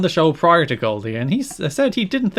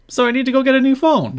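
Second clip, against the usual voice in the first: much higher mock voice, "Yo, my phone's broken" pitched way up; mock Goldilocks; 11 semitones higher than usual. Host mimicking Goldilocks on the phone store video, high mocking voice. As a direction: lampoon her with a high put-on voice. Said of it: "she's like".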